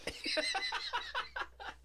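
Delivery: high-pitched squeal of laughter. Real, not acted. Big laugh.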